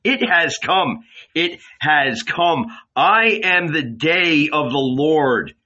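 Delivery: ecstatic prophecy. Acted. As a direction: proclaim the fire with rising joy. Jesus's fiery prophecy.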